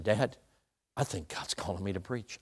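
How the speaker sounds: low, soft admission; quiet confession. Retold or imitated. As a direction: confide it gently to your dad. Retold confession to his father.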